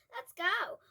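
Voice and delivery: urgent high loud call; shouting. A narrator's reading. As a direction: yell it as you run. Boys fleeing the thieves.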